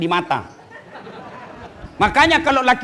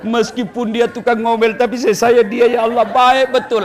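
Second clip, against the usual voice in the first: grieving husband; 2 semitones higher than usual. Retold or imitated. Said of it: "lalu suamimu menangis".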